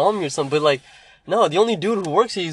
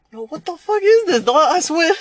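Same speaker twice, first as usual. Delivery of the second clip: very high, loud exasperated yo; exasperated customer; 13 semitones higher, 5 dB louder than usual. Retold or imitated. Customer calling after him in disbelief, very high. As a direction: whine it in disbelief.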